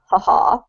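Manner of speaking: ha ha with a breathy h. Shown as a demonstration. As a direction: say ha ha with a strong breathy h. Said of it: "this sound here".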